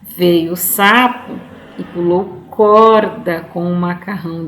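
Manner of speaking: put-on slow, expressive reading to children; children's poem reading. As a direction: read it slowly, playing up key words. Reads the poem aloud. (leitura expressiva de poesia infantil)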